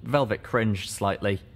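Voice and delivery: ordinary delivery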